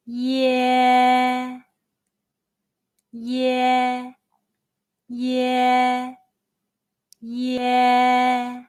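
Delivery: each "Yeah" held long and level; slow pronunciation model, "Yeah" drawn right out; prolonged ye. Shown as a demonstration. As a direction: stretch every "Yeah" on a steady note, pausing for repetition. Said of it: "mimic the mouth shape in the video carefully and repeat after".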